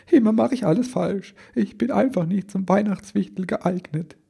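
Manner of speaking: very high, wailing little-elf voice; stammering self-reproach. A narrator's reading. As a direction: lament your failure, high and tearful. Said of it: "stammelte der Kleine". (hoch und weinerlich jammernd)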